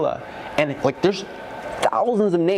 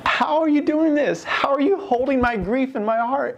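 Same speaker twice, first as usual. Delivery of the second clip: high, pleading retold question; overwhelmed wonder; 10 semitones higher than usual. Man asking God how he holds his grief, overwhelmed. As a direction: ask it in high, overwhelmed disbelief.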